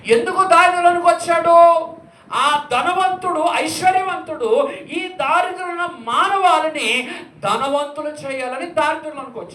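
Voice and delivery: loud, high, impassioned preaching; fiery preaching. Acted. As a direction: preach it with full fire to the congregation. Preaching sermon at full force. (ఉద్వేగభరితమైన ప్రసంగం)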